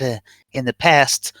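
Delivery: ordinary talk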